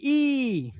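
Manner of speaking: ई held long and drawn out. Shown as a demonstration. Teacher showing students a long ई. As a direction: stretch the ई into a long vowel. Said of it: "तो इसके लिए आवाज लंबी".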